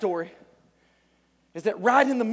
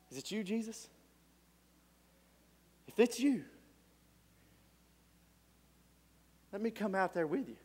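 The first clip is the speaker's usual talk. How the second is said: much softer, hushed uncertain question; hesitant Peter; 2 semitones lower, 13 dB quieter than usual. Acted. Peter quietly asks Jesus on the water, much softer. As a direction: ask it timidly under your breath. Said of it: "Peter says".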